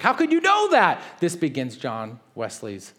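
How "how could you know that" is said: much higher, louder incredulous question; stunned Wesley; amazed disbelief. Retold or imitated. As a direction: squeak it out in shock. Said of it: "And he's like".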